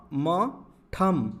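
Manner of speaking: ठम built sound by sound, very slow; spelled out slowly. Shown as a demonstration. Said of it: "ये है ठ".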